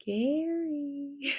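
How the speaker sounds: soft, slow, eerie drawn-out call; horror-film voice. Retold or imitated. Sedated patient calling Gary, like something from a horror film. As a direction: call the name faintly, stretching it like a ghost. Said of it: "talking like something out of, like, a horror film".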